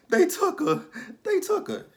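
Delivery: very high, loud mock scream; frantic scream. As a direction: shriek it in panic. [name] retells kidnapping in panic.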